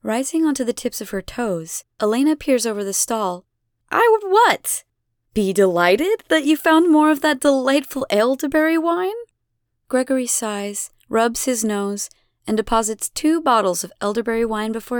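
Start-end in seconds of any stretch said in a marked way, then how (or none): 3.9-9.2 much higher, louder, sarcastic sing-song; exaggerated fake surprise; mock delight. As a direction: pretend delight to force him. Elena feigning delight.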